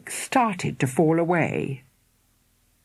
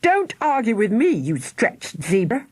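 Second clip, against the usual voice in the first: angry scolding; 6 semitones higher than usual. A narrator's reading. Mother scolding daughter.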